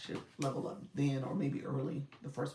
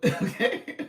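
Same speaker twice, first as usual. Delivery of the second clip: a real burst of laughter, then okay; laughing okay; 7 semitones higher, 9 dB louder than usual. Real, not acted. Reacting to a viewer comment.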